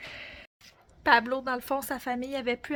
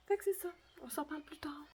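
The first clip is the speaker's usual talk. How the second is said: whispering; 3 semitones higher, 9 dB quieter, faster than usual. Real, not acted. Signing off in a whisper.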